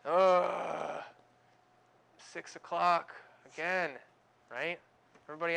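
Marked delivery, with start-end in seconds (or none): 0.0-1.0 a loud, weary morning groan; groan of dread. Acted. Preacher groaning at the six o'clock alarm. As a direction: groan like the alarm just went off. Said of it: "We're groaning".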